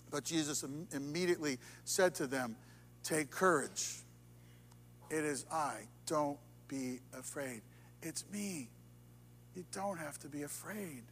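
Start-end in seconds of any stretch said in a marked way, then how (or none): 3.0-11.0 quiet the fear gently; reassuring. Acted. Jesus to his scared disciples, softly reassuring.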